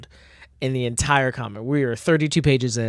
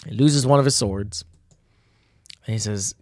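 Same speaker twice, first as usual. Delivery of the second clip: ordinary delivery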